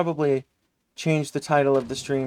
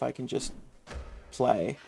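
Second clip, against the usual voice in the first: rough, unwarmed-up voice; a hoarse voice first thing; 9 dB quieter than usual. Real, not acted. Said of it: "My voice was not ready for this".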